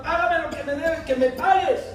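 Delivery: high, loud choking demand; angry shout. Acted. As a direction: throttle him for the money. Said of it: "lo empieza a ahogar".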